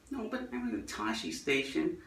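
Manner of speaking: soft seventies American accent, imitated. Luke insisting he's at Tashi station, seventies American accent. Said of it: "that kind of seventies American accent".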